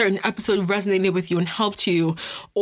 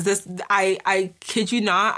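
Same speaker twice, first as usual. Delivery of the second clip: normal talking voice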